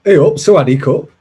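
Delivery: Thai greeting done in a hammed-up northern English accent, "Hey up" thrown in front; quick, half-hearted try at a northern English accent, put on the spot. Shown as a demonstration. Asked by his co-host to greet in Thai, a mock northern English accent. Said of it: "but in a northern accent?".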